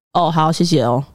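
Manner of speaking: low, deflated thanks after a sigh; sigh, then thank them with little energy; resigned politeness. Retold or imitated. Groomer seeing off a customer who won't return, resigned. Curt farewell to departing angry customer.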